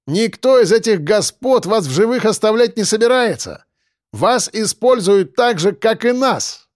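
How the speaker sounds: high, calling voice; projected persuasion. Acted. Defender bargaining with the gang leader, projected persuasion. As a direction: persuade him from a distance. Defender calls proposal across cave.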